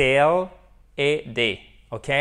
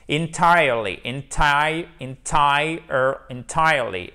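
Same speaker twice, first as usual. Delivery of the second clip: "entirely" built up piece by piece, TIRE stressed; stress on second syllable; 3 dB louder, slower than usual. Shown as a demonstration. Teacher drilling an adverb, stress on second syllable. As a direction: land hard on the "tire" in "entirely". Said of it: "vous avez votre accent tonique qui se trouve ici".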